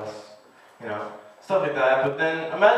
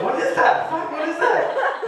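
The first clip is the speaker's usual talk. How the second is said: mock panic; 13 semitones higher, slower than usual. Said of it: "You're like".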